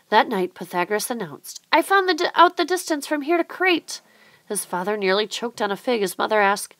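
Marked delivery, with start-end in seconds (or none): 1.7-4.0 much higher, louder; boy announcing his discovery to his family, excited; excited announcement. A narrator's reading. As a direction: announce it proudly. Proud announcement at dinner.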